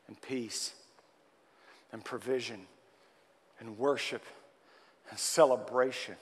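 usual speaking voice

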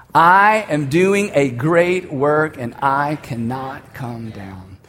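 slow, weighted, fading at the end; a slow resolve spoken with the room; slow solemn words said together; slow solemn declaration. Shown as a demonstration. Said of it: "I want us to say it one more time".

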